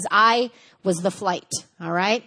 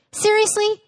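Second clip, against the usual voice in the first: sharp high scoff; scoffing disbelief; 10 semitones higher than usual. Acted. Passive-aggressive partner scoffing at McDonald's, incredulous. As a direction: toss it back, incredulous.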